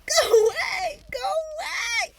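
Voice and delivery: high, loud shrieking at a bug; terrified scream. Real, not acted. Shrieking at the roach crawling near her. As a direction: scream it off in real fright.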